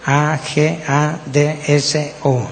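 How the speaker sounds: slow, letter by letter; each letter set apart, unhurried; spelling out. Shown as a demonstration. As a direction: spell it out slowly for note-takers. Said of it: "se las voy a deletrear también".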